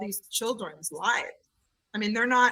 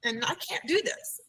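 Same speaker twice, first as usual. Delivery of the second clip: put-on puzzled child; 8 semitones higher than usual. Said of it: "he's looking at me like".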